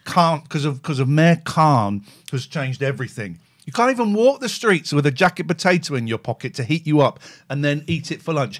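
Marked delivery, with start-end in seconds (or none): none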